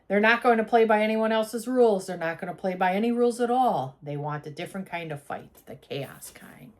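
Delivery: dramatic blurb reading. Real, not acted. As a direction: build it like a trailer, then drop to a hush. Dramatic end of blurb read aloud.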